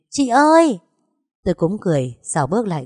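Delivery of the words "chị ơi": put-on high, loud child's delighted cry; a little boy bursting out happily; excited call. Little boy greeting his neighbour, excited high cry. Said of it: "lập tức reo lên".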